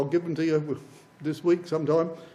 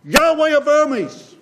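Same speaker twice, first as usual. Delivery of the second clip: much higher, louder, slow proclamation; prophet proclaiming; 9 semitones higher, 8 dB louder, slower than usual. Retold or imitated. Prophet crying out to disbelieving people, loud and slow. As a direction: cry it out like the prophet to doubters. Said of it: "the prophet kept saying".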